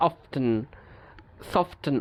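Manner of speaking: অফটেন and সফটেন with t sounded between f and n; T pronounced. Shown as a demonstration. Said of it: "উচ্চারণ করতে কষ্ট হচ্ছে".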